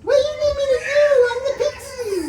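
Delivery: very high, loud pixie squeal; squeaky pixie voice. Acted. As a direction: play a tiny excited pixie. Player voices the pixie character.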